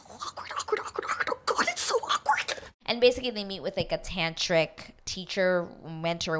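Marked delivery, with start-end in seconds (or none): 0.1-2.6 hushed, cringing whisper. Real, not acted. Vlogger recoiling from an awkward date recap, whispering. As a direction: breathe the words like a cringe secret.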